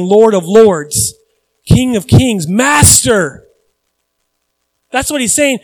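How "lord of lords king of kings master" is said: slow, loud proclamation; solemn loud declaring; proclaimed titles. Shown as a demonstration. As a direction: declare each title slowly.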